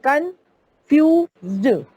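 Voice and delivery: Confused drawn out slowly, split into syllables. Shown as a demonstration. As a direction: break confused into separate, slow beats. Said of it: "ज्यादा syllable आ रहे हैं".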